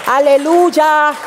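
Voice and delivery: slow, stretched aleluya; preacher's long aleluya; drawn-out aleluya. Real, not acted. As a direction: let the aleluya ring out slowly.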